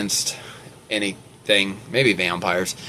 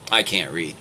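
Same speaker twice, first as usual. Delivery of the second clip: ordinary delivery, nothing marked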